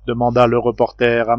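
ordinary delivery, nothing marked

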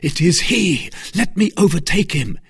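high, loud, urgent outburst; angry cry. Acted. D'Artagnan straining after his enemy, angry cry. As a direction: cry out, burning to give chase. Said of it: "pale with anger".

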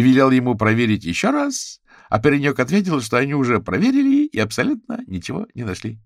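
high, loud gossipy report; lively, high-pitched recounting; animated Bitsy. A narrator's reading. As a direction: retell overheard talk with exasperation.

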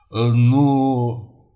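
drawn-out, hesitant prompting; stall, unsure what to say; flustered father. Acted. Father turning to his daughter, flustered and hesitant.

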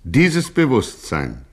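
usual speaking voice

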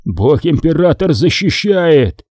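usual speaking voice

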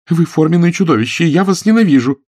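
high, loud angry cry; furious outburst. A narrator's reading. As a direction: shout at him in fury. Quarrel outburst. (яростный выкрик)

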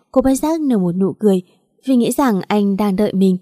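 plain reading voice

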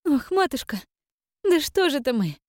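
ordinary delivery

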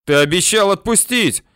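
high-pitched pleading yell. A narrator's reading. Bandit begs to be let go as promised, yelling. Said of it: "орёт Адик".

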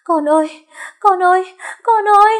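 high, loud desperate wail; frantic mother's shriek; screaming. A narrator's reading. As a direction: scream for your child. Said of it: "gào thét".

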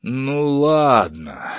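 very slow, drawn-out giving in; languid. Acted. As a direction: give in with a languid, long sigh of words. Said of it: "сказал Семён Семёнович томно". (томно, нараспев)

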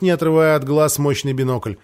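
ordinary delivery, nothing marked

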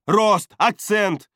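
loud, high, drawn-out bark; barked demand. A narrator's reading. Чара demands height and accent from Бардак, loud bark. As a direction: bark out each word. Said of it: "рявкнула она".

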